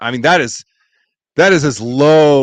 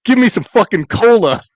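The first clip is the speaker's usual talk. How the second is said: higher, louder rude demand for cola; mock yell; 9 semitones higher, 4 dB louder than usual. Farva demanding his cola from the waitress, mock yell. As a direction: bark the order like an obnoxious customer. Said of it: "Leader is French for".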